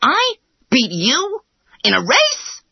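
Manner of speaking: high incredulous hare exclaiming; exclaiming in disbelief. A narrator's reading. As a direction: scoff at the very idea. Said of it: "exclaimed the hare".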